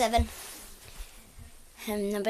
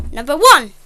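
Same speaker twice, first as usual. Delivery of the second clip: annoying noise; 13 dB louder than usual. Pretend. Listing annoying sounds.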